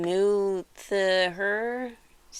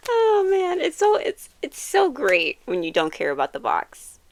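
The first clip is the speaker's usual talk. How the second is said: excited squeal; 10 semitones higher, 4 dB louder than usual. Real, not acted. Gushing about the box.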